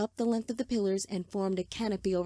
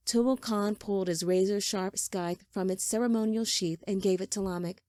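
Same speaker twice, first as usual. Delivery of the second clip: usual speaking voice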